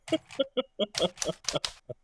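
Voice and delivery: high, real giggle; laughing. Real, not acted. Player tickled by their own comeback, laughing. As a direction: burst of high chuckling.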